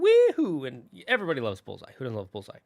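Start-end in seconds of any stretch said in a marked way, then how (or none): 0.0-0.6 a very high, loud cowgirl whoop; Jessie's yee-haw. Retold or imitated. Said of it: "she just has such a great personality".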